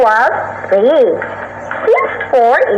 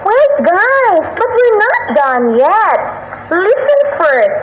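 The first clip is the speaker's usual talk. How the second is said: calling them back; 9 semitones higher, slower than usual. Acted. Peer teacher calls classmates back.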